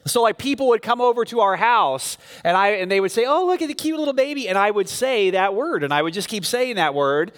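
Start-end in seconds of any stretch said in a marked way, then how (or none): 3.3-4.5 put-on high, quick baby-talk coo. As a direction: gush over the cute baby, pitched up. Guests cooing over a baby.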